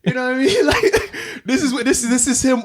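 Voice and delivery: high-pitched words breaking into laughter; laughing. Real, not acted. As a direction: laugh through it, barely getting words out. Laughing at coach's sayings.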